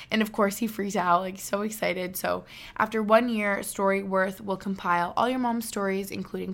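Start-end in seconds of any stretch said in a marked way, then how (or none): none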